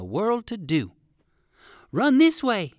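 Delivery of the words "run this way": urgent high-pitched shout; calling out. A narrator's reading. Poodle doggy calls to the rabbit as foxes attack, calling out. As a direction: call it out loud and high. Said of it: "called Fido".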